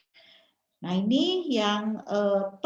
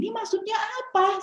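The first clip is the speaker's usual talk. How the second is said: much higher, retold outraged question; indignant challenge; 12 semitones higher than usual. Confronting a mother in private message, indignant. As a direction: demand an explanation in a high voice.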